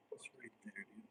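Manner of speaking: soft whispered frustration. Real, not acted. Leatherworker muttering at the needle, whispering. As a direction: hiss it under your breath.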